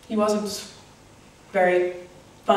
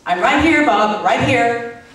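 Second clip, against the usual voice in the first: exasperated insistence; 5 dB louder than usual. Acted. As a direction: insist loudly that you're already here. Exasperated repeat to lover.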